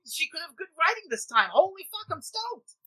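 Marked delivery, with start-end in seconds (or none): 1.5-2.8 much higher, overjoyed and gushing; giddy excitement. Retold or imitated. Telling cohosts about the casting news, overjoyed. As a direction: let the joy spill out. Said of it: "I was like".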